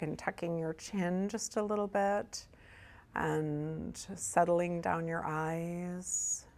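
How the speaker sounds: soft, hushed meditation guide with long pauses; guided meditation. Real, not acted. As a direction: ease them gently into breath and body, softly. Leads a meditation.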